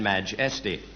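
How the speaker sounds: ordinary talk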